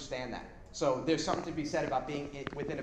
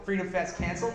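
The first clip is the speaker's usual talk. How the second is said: usual speaking voice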